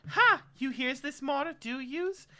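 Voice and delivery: high, loud, incredulous character voice; scoffing seamstress. Acted.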